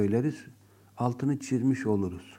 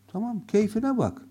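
higher mock indifference; sarcastic "suit yourself"; 9 semitones higher than usual. Shown as a demonstration. Teacher playing a sulky student telling someone to suit themselves. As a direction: act like you don't care, meaning stay. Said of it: "Gitme demektir bu".

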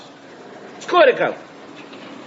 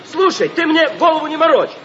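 shouting at the supplier; angry shout; 7 semitones higher than usual. Acted. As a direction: bark it down the line in anger. Angry call about missing bricks. (гневный крик в трубку)

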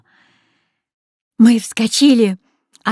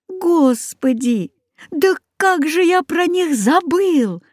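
sudden realization; 8 semitones higher, slower than usual. A narrator's reading. Boy suddenly remembers the geese.

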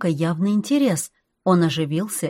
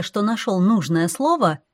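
ordinary delivery

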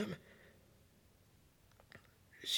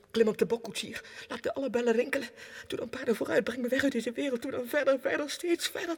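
louder, higher, driving on; urgent calling; 4 semitones higher, 4 dB louder, faster than usual. Acted. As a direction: urge the horses faster and faster. Urging the troika away. (opgejaagd en luid aansporend)

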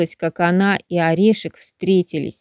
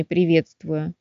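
ordinary delivery, nothing marked

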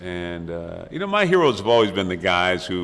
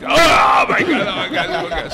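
much higher and louder shriek that fades into laughter; panicked shriek; 12 semitones higher, 9 dB louder than usual. Retold or imitated. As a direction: imitate a baby losing it, shrieking at the top then trailing off. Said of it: "the baby just lost it".

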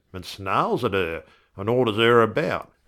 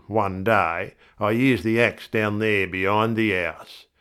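usual speaking voice

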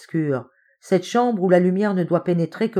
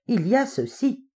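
raised pitch, savouring the reveal; exultant; 7 semitones higher than usual. A narrator's reading. Hopkins about to refute his friend, exultant. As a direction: announce your winning find. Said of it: "exulta Hopkins".

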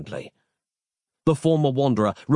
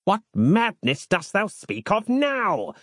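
slow, much higher outraged yell; the old monk yelling in outrage, slow and high; 10 semitones higher, slower than usual. A narrator's reading. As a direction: bellow the question at him, slowly. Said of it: "yelled Harland aloud".

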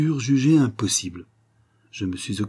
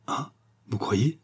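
slow, softer, voice still trembling with emotion; still shaken; 3 semitones higher, 3 dB quieter, slower than usual. A narrator's reading. The countess, still moved, answers the doctor slowly. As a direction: let the shock linger in each word. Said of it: "encore tout émue".

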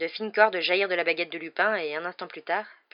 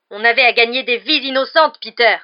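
very loud impassioned retort; terrible fury; 6 semitones higher, 16 dB louder than usual. A narrator's reading. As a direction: hammer it home in rage. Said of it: "animé d'une terrible fureur". (réplique furieuse)

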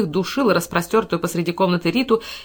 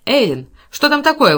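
raised, loud, demanding voice; commanding shout; 6 semitones higher, 6 dB louder than usual. A narrator's reading. Cowperwood demands answers through the door, commanding shout. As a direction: call out with authority. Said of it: "властно закричал он".

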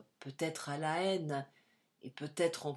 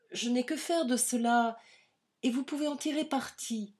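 flustered refusal; 7 semitones higher, 5 dB louder than usual. A narrator's reading. Louise refusing the money, flustered.

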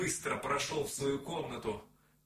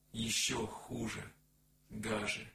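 usual speaking voice

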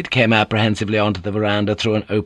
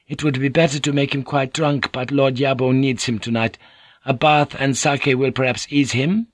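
Mariko's voice; 5 semitones higher than usual. Acted. Mariko greets Blackthorn, higher courteous lady's voice.

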